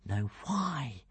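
soft, puzzled murmur; musing to himself. A narrator's reading. Hiccup wonders to himself in the boat, musing softly. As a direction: think aloud quietly. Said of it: "frowning to himself".

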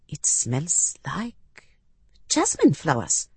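a touch of hesitant surprise; surprised. A narrator's reading. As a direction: trail upward on "like", then name it in mild wonder.

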